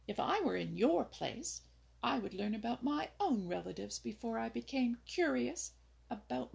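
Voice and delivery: a little bird lecturing a rabbit; sharp scolding voice. A narrator's reading. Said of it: "in her sharp, scolding voice".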